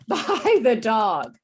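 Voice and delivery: slow, loud, overdrawn BY THE DOG; English teacher diction. Shown as a demonstration. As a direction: stretch BY THE DOG out for learners. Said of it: "I'm pronouncing it, you know, like an English teacher".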